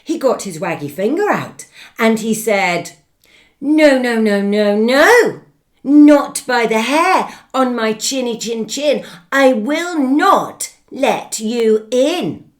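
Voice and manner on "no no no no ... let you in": defiant refusal. Acted. Pig refuses wolf.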